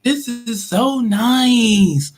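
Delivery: high, drawn-out dreamy admiration; mock admiring shopper. Shopper in the mall imagined, gushing slowly and high. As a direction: gush over the mall like a carefree shopper. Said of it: "and be like".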